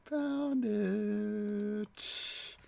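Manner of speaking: playful drawn-out high found it; sing-song. Real, not acted. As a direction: croon it high and slow like a little victory tune. Repeats it stretched out, sing-song.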